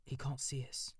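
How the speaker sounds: plain reading voice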